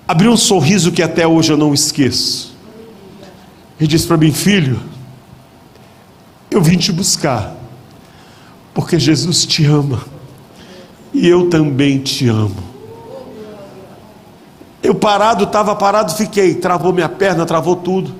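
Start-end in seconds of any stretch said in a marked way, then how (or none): 4.3-12.7 tender father. Retold or imitated. Father tenderly fetching his son.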